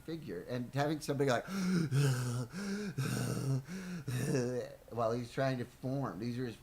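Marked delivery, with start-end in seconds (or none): 1.5-4.9 gasping for air. Acted. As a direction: gasp as if waking unable to breathe. Acting out waking up gasping for air.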